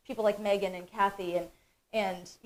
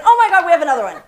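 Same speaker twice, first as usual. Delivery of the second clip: loud, high burst of surprise; excited surprise; 7 semitones higher, 13 dB louder, much faster than usual. Real, not acted. Host finding another giveaway, excited and surprised. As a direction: exclaim it loudly, pitch jumping up.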